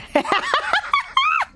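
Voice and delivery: high squealing giggle; helpless high-pitched giggle; squealing laugh. Real, not acted. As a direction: burst into a shrieking laugh. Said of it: "Valley Girl accent".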